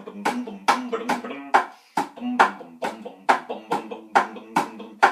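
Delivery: thumping vocal bass notes; beatboxed bass guitar; imitated bass line. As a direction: mouth a punchy bass riff in short bursts. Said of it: "you can hear Shrek's bass going".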